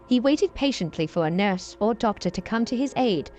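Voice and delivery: plain reading voice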